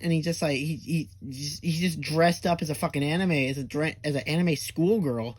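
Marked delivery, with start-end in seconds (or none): none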